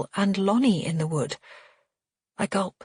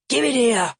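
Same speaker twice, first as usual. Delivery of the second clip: loud, raised command; angry order; 6 semitones higher, 5 dB louder than usual. A narrator's reading. Father grabs for the ring, angrily ordering. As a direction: order it handed over at once.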